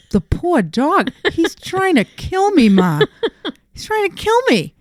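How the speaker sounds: high, squeaky disbelief with a laugh; incredulous protest. Retold or imitated. Groomer answering her mom, high incredulous protest. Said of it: "I go".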